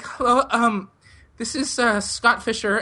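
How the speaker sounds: halting, high-pitched phone introduction; high, hesitant mock-panicked caller; nervous high voice. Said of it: "ten octaves higher than it normally is".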